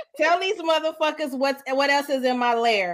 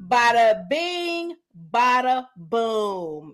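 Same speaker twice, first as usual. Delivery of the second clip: slow flourish; 3 semitones lower, much slower than usual. Real, not acted. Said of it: "catchphrase flourish".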